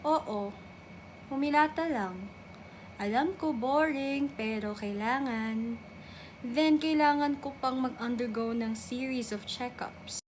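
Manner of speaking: usual speaking voice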